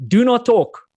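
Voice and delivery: put-on loud high shout in a Polish accent. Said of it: "she shouted out in this Polish accent".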